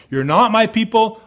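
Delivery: high, loud pronouncement; forceful rejection. Acted. God to people who broke the covenant, forceful rejection.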